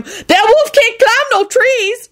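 very high, drawn-out shriek. A narrator's reading. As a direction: shriek it slowly at the top of your range. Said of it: "she shrieked".

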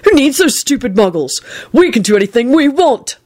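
rally your friend; Neville, excited. A narrator's reading.

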